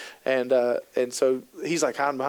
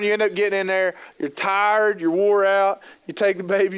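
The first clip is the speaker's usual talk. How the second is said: ordinary talk, nothing marked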